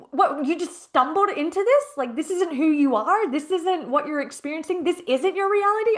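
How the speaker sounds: retold high-pitched, rising disbelief; incredulous questioning. Coach re-enacting her challenge to a client, incredulous.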